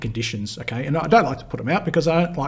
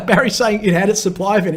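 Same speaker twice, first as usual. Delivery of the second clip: laughing; 7 semitones higher, 6 dB louder than usual. Real, not acted. Laughs while reading a comment.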